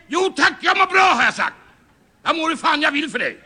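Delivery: high, exasperated shouting; angry yelling. Acted. Caller needing the father threatens the child, shouting high. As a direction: bellow threats at a stubborn child. Loses temper with child on phone.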